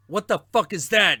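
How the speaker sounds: high, loud startled yell; startled shout. Retold or imitated. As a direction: shout it in shock. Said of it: "people would be like".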